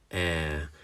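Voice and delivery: "Air" held as a lone vowel sound; isolated sound. Shown as a demonstration. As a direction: sound out "Air" slowly and clearly. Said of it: "this bit is gonna make square".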